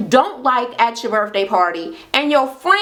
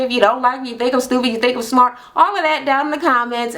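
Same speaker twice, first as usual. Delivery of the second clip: ordinary talk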